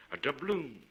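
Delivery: ordinary delivery, nothing marked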